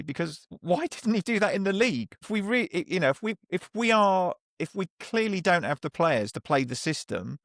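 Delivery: real frustration spilling into the questions; frustrated. Real, not acted. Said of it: "which frustrated the shit out of me".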